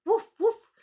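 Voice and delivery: loud high playful woof; dog bark. Acted. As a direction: bark like an eager puppy. Dog voices in read-aloud.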